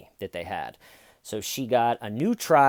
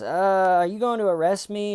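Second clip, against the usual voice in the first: fast, higher mock blurt; a suspect slipping in the worst bit at speed; rushed question; 8 semitones higher, 4 dB louder than usual. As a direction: jam the question in quickly. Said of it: "jam in at the very end with talking fast".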